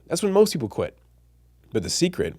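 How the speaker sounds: usual speaking voice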